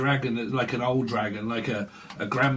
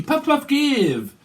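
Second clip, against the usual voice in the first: squeaky high yelp; 12 semitones higher, 6 dB louder, much slower than usual. Acted. Joking about smoking puff.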